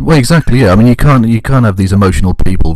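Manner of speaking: loud sarcastic agreement. Real, not acted. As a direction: boom out the mock agreement with heavy irony. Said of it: "you're a little loud now".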